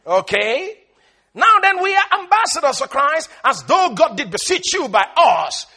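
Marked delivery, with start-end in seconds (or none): 1.3-5.7 loud, high preacher's proclamation; full-force Bible reading; declaimed scripture. Acted. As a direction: ring out the verse to the congregation. Declaims the verse.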